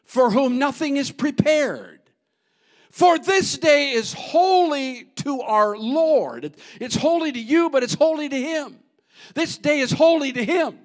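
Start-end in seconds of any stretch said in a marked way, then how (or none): 2.9-10.8 thundering pulpit delivery; full-force preaching. Real, not acted. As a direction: proclaim it over the congregation.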